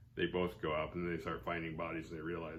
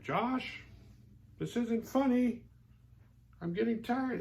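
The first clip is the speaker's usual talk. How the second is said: very high imitation of a fretting girlfriend; mock worried whine; slower than usual. Girlfriend calling her missing boyfriend, high mock fretting. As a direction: whine it high, drawing out the words. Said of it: "worrying and just kinda freaking out".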